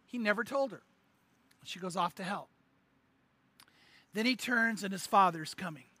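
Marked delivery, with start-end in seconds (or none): none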